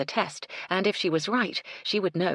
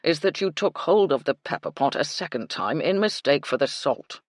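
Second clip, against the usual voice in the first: a stiff, brittle butler; cool, correct, a little tight; 4 dB louder than usual. A narrator's reading. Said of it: "in his brittle voice".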